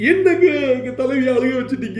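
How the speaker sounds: mock lament. Fan protesting that the leader was made to cry, mock lament. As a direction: protest with a long, fake-tearful whine.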